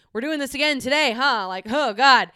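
half-mock wail about the daily grind; exasperated groan. Recalling dreaded daily job.

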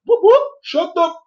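very high mock siren, then a quick telling-off; drive-by cop. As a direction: whoop like a squad car passing. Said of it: "they drive by and they're like".

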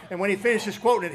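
normal talking voice

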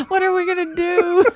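high-pitched mock-crying lament; hammed-up tearful whine; mock wail. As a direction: wail it in pretend despair. Mock lament over poor baby.

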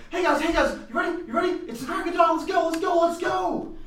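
high-pitched, overexcited little guy; eager, squeaky rush of words; excited gnome. Acted. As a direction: chivvy the sleepy party along eagerly. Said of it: "I'm just so excited".

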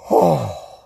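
big audible exhale; exasperated sigh. Real, not acted. Man letting out frustration over his ruined coffee, sighing. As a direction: blow out a long breath.

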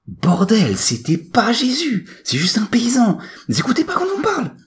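very high, loud, indignant yelp; squawking high-pitched troublemaker; exasperated twin. A narrator's reading. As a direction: protest shrilly at not being listened to. Exasperated twin corrects professor.

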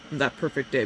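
usual speaking voice

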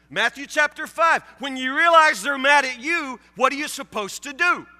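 usual speaking voice

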